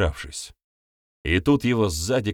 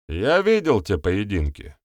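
plain reading voice

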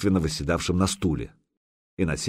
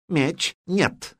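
curt retort; 9 semitones higher than usual. A narrator's reading. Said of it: "отрезал переводчик".

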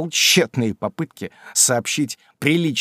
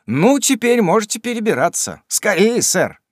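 urging; 10 semitones higher, 5 dB louder than usual. Acted. Sam urging master over fence.

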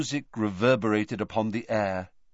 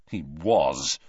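low, understated savagery; 6 semitones lower than usual. A narrator's reading. Harry answering about Snape, with restrained savagery. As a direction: say it low and bitter. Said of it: "said Harry savagely".

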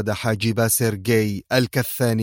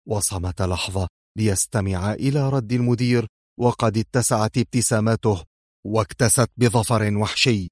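usual speaking voice

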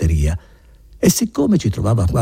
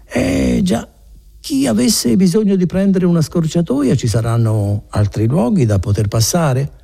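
higher, overly casual put-on nonchalance; affected indifference; 10 semitones higher than usual. Renzo fishing for a shortcut, with affected indifference. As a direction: act like you couldn't care less, too obviously. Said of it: "con un'aria di indifferenza portata fino all'affettazione".

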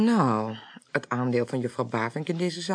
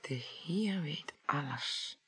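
whispered slowly, almost to himself; a stunned whisper; awed whisper; 3 semitones lower, 14 dB quieter, slower than usual. Acted. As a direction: breathe the words out, slow and hushed. Repeats dying words, awed.

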